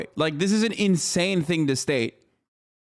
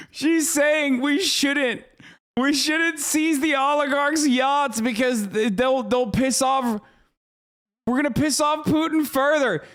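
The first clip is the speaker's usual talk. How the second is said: spelling out an absurd argument to the chat; incredulous; 7 semitones higher than usual. Real, not acted. Streamer recapping the pundit's point, incredulous and raised. Incredulous rant at news clip.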